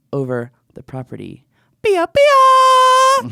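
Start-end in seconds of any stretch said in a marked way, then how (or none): 1.8-3.3 very high, loud shouted gun noise; mock gunshot. Angry neighbour shooting at a drone, mock gunshot. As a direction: blast out a cartoon gunshot.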